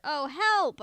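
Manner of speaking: high, loud cry for help; desperate yelp for help; frightened cry. A narrator's reading. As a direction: call out in panic. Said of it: "I cried".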